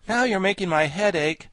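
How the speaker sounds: put-on mildly fed-up boyish objection; exasperated boy. A narrator's reading. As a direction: complain crossly. Tip complains amid the argument.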